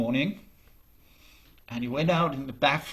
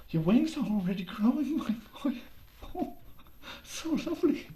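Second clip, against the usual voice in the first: much higher, delighted gushing; excited mother; 11 semitones higher, 4 dB quieter than usual. Acted. Mother sees her boy's wings growing, high and excited. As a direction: coo over him like a thrilled mom. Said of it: "she was excited".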